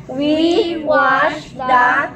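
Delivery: word by word, very slow; slow model reading. Shown as a demonstration. Teacher reading a story to class, slowly word by word. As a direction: stretch each word for learners to follow.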